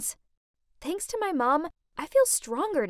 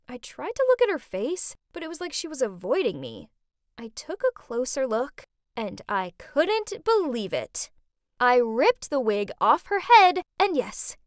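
ordinary delivery

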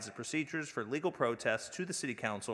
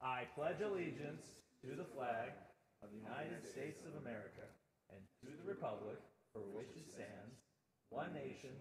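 recited in unison; 14 dB quieter than usual. Real, not acted. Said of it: "stand and salute the flag and say it in unison".

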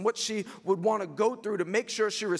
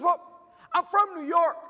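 high imitated female voice; mock-perky young lady; excited young woman; 11 semitones higher, 6 dB louder than usual. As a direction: mimic her explaining, pitched way up. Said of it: "She goes".

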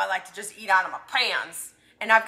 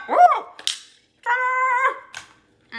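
high, gleeful whoop; excited cheer; 13 semitones higher, much slower than usual. Real, not acted. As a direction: celebrate like you just won. Triumphant cheer after a big bite.

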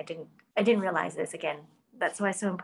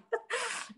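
high laugh; 6 dB quieter than usual. Real, not acted. Laughing at her own guilt.

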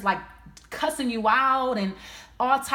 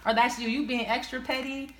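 ordinary delivery

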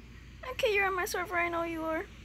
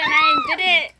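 very high, loud burst of triumph; triumphant squeal; 5 dB louder, faster than usual. Real, not acted. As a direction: squeal it in victory. Triumph at passing laser part.

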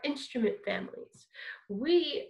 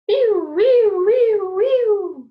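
high, loud sliding wees; high wee warm-up; 8 semitones higher, 12 dB louder, slower than usual. Shown as a demonstration. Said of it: "Let's try this one".

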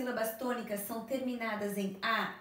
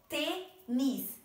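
"tênis" very slowly split into tê, nis; syllable by syllable. Shown as a demonstration. Teacher counting the syllables of tênis for pupils, syllable by syllable. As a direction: separate both syllables of "tênis" with a pause. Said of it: "duas sílabas".

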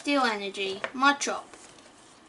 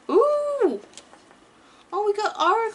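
surprised ooh; 9 semitones higher, 5 dB louder than usual. Real, not acted. Surprise at card.

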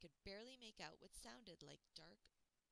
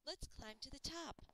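high, louder call; 9 semitones higher, 8 dB louder than usual. A narrator's reading. Girl calls to her friend to point out a tree, louder and higher. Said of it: "she called out".

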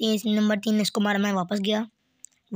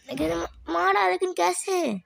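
baffled disbelief; 6 semitones higher, slower than usual. Real, not acted. Baffled at losing the fight.

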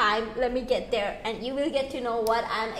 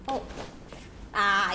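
normal talking voice

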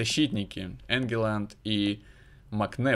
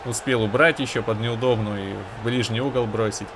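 normal talking voice

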